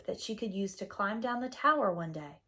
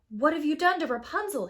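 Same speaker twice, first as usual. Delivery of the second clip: higher, louder anguished cry; alarmed cry; 6 semitones higher, 5 dB louder than usual. A narrator's reading. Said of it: "he cried".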